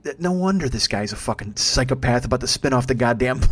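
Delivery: ordinary talk, nothing marked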